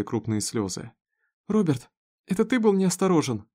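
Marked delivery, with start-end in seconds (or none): 1.5-3.5 high, distressed questioning; upset accusation. A narrator's reading. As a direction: confront the child in alarm. Mother confronts son over injury. (с тревожным упрёком)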